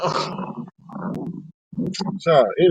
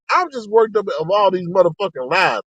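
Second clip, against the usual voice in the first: usual speaking voice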